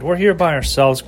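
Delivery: ordinary delivery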